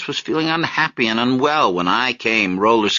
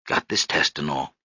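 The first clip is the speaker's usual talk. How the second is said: softer, lower aside; quiet, lower brush-off; 7 semitones lower, 5 dB quieter than usual. A narrator's reading. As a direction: let him off easy, gently. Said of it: "Marius's voice was soft".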